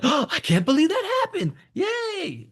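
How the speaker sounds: very high, gushing, disbelieving squeal; mock shocked excitement. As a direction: overdo the amazed cheer. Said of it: "not a shocked excitement".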